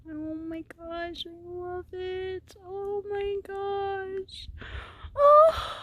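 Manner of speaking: high, slow, voice breaking with emotion; overwhelmed, near tears, rising; tearful joy. Real, not acted. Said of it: "I'm not crying, you're crying".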